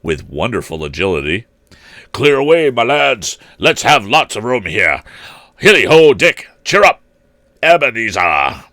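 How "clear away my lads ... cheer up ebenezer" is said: jovial shout. Acted. Fezziwig calling to apprentices.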